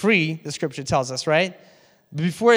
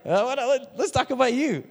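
mock indignant turnaround; 7 semitones higher than usual. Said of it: "And it's like".

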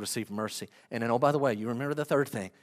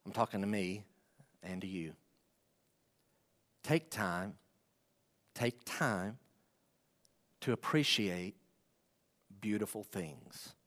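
quiet gentle urging; 6 semitones lower, 8 dB quieter than usual. Real, not acted.

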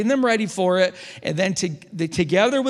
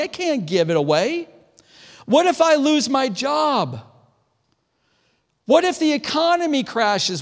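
worried voice; 8 semitones higher than usual. Retold or imitated. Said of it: "They're saying things like what we say".

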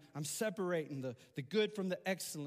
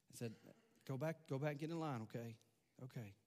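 gentle refusal; 2 semitones lower, 6 dB quieter than usual. Retold or imitated.

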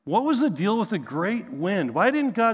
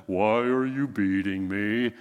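low, slow, put-on donkey voice; talking donkey; 8 semitones lower, slower than usual. Preacher voicing Balaam's donkey to the congregation, low and slow. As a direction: drop low and drag it out like a hurt donkey. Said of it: "the donkey just stops and says".

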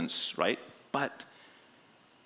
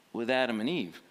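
ordinary delivery

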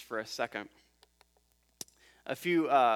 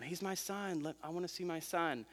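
softer, higher plea; imagined pleading mother; 4 semitones higher, 10 dB quieter than usual. Retold or imitated. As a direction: softly plead to get through a crowd. Imagined mother pleading through crowd.

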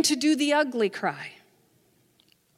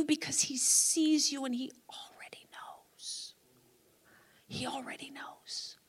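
much softer, breath-light close of a prayer; hushed assurance; 9 dB quieter than usual. Retold or imitated. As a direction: drop into a near whisper. Prayer falls to hush.

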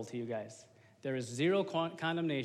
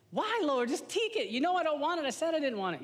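whiny pleading; 11 semitones higher, 4 dB louder than usual. Retold or imitated. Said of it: "God is a complete gentleman".

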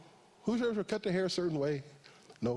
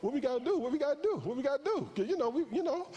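excited reply; 8 semitones higher than usual. Retold or imitated. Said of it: "I'm like".

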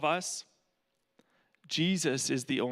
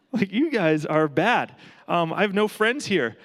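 exasperated; 5 semitones higher, 7 dB louder than usual. Retold or imitated. Exasperated at bad friends.

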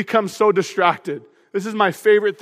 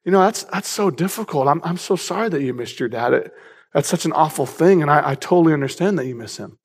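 lowered, soothing sympathetic voice; low, tender therapist impression; soft counselor voice; 4 semitones lower than usual. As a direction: imitate a counselor gently giving sympathy back. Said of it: "in a really soft voice".

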